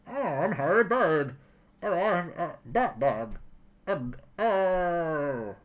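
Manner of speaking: muffled, drawn-out masked villain voice; hammed-up villain impression through a mask; Bane.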